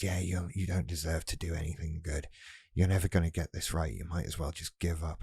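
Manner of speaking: a quiet voice undermining from behind; BPD inner voice. Acted. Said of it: "B P D comes along".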